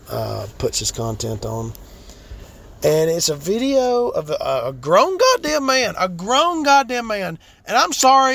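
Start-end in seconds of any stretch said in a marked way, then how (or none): 4.7-7.4 much higher and louder, slow and emphatic; outraged disbelief. Real, not acted. Ranting about a grown man crying, outraged. 7.6-8.3 indignant. Real, not acted. Ranting about crying man.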